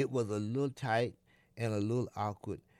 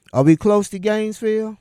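anxious loud question; 9 semitones higher, 15 dB louder, faster than usual. Acted. Anxious boy asking loudly.

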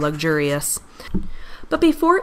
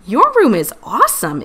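put-on louder, thrilled kid voice; excited exclamation; 4 semitones higher, 5 dB louder than usual. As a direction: gush with delight. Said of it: "Itty exclaimed".

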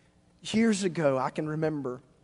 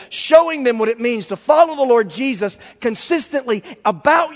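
loud, raised-pitch sermon delivery; fervent preaching; 7 semitones higher, 9 dB louder than usual. Real, not acted. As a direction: drive each point home forcefully. Builds exhortation at full force.

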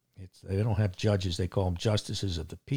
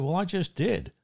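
raised pitch, indignant retelling of telling off the judge; higher-pitched, indignant pushback at the judge; retold angry retort; 8 semitones higher than usual.

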